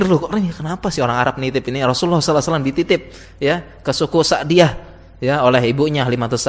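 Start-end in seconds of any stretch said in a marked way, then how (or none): none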